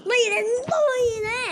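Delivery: loud high yell in the fight; shouting. Real, not acted. Yelling in a boss fight. (ตะโกนเสียงสูงดัง)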